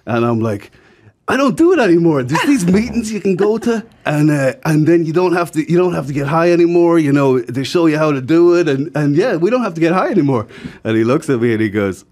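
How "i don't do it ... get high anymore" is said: eager high preaching. Retold or imitated. Retelling preaching sobriety to customer.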